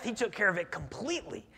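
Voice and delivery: usual speaking voice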